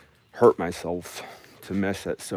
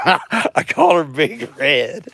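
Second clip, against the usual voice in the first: high, slow, giggling as she says it; laughing at her own joke, higher and slower; 8 semitones higher, 8 dB louder, slower than usual. Pretend. To the camera about her mower, laughing high and slow.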